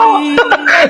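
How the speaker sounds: a short high wry chuckle; short laugh. Real, not acted. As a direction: let a brief rueful laugh out. Laughs after the exclamation.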